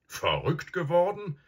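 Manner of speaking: ordinary delivery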